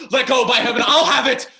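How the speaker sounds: high, loud shout while grabbing; struggling shout. Acted. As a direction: wrench the cup away, shouting. Hamlet wrests the cup away.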